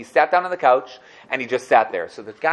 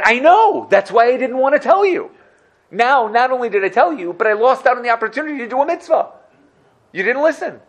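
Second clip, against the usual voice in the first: high, loud mock-exasperated scolding; much higher, louder exasperated reproach; told-you-so rebuke; 11 semitones higher, 6 dB louder than usual. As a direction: throw it back at him, I told you so. Rebbe chiding the banker.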